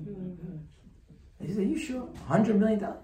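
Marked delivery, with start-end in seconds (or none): 1.6-3.0 high, incredulous double-check; can't believe the sum; amazed disbelief. Retold or imitated. Man in line hears of the check, amazed.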